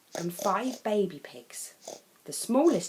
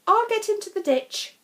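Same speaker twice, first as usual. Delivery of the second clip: high, louder determined voice; determined farmer; 14 semitones higher, 7 dB louder than usual. Acted. Farmer announcing she will climb into the muddy ditch. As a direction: take charge.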